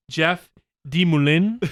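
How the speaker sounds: Demoulin drawn out slowly; mispronounced name. Retold or imitated. Co-host quoting the show's way of saying a fighter's name, mispronounced. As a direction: say Demoulin slowly, as the show pronounces it. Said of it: "they do pronounce the, the Druskai as".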